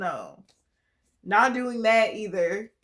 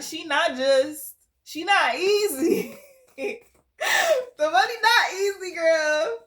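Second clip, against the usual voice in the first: higher, louder, slow laughing wail; laugh-crying; 9 semitones higher, 6 dB louder than usual. Real, not acted. Reader ranting to a girlfriend, laughing wail. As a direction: cry it out through laughter.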